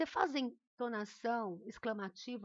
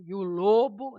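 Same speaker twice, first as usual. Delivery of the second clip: very loud, ominous storybook announcement; wolf story voice; 15 dB louder than usual. Shown as a demonstration. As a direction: boom it out to introduce the wolf. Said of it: "Por exemplo, quando eu falo".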